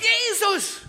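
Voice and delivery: high, loud call of the name; one last desperate cry; piercing shout. Retold or imitated. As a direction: beggar calling out to Jesus. Blind man shouts once more.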